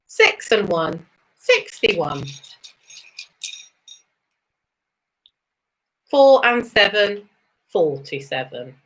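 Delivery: sing-song bingo number calls, rising then falling; bingo caller. Acted. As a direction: call each number like a bingo hall host.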